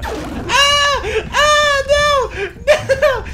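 high, loud panicked screams; terrified screaming. Real, not acted. Player attacked by a giant bug in the game, loud terrified screams. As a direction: scream like something huge is on you.

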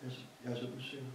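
hushed and rushed, almost swallowed; timid quiet voice. Retold or imitated. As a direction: mumble it fast and small, unsure of yourself. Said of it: "så siger Sydney Banks til ham der". (Sydney siger det stille og usikkert til psykologen)

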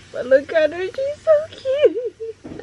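overwhelmed, almost crying with joy; happy crying. Real, not acted. Gushing to viewers over her new bag, voice breaking into tears. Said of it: "I'm gonna cry".

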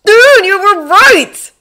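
put-on very high, loud, drawn-out teen amazement; awestruck. A narrator's reading. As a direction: burst out in slow, high awe. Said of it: "sounding oddly s-, awestruck".

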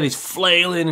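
plain reading voice

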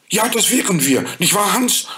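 put-on high, eager child voice; hopeful girl. A narrator's reading. Frieda asking the fairy for the flower, in an eager high voice. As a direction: ask eagerly like a little girl.

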